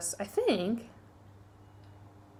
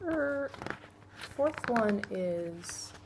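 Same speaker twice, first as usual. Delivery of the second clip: slow, soft, stalled searching; trying to remember; 2 semitones higher, 8 dB quieter, much slower than usual. Real, not acted. Vlogger forgets the fourth love language mid-list, stalling. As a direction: drag it out quietly while you rack your memory.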